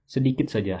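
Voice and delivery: usual speaking voice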